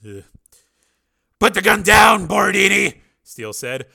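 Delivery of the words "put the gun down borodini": loud, high order at gunpoint; shouted command. Acted. Steele orders a cornered gunman to disarm, shouted. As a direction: bellow it to make him drop the gun.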